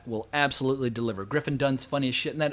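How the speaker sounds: ordinary talk, nothing marked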